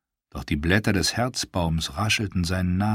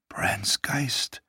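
whispered thought; 6 semitones higher, 5 dB quieter than usual. A narrator's reading. Theon's frightened private thought.

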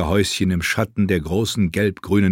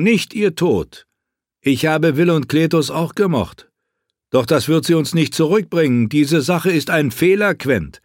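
higher, insistent warning; arguing friend; 7 semitones higher, 3 dB louder than usual. Acted. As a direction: press the prince hard. Garrus argues with Quentin. (höher, eindringliche Warnung)

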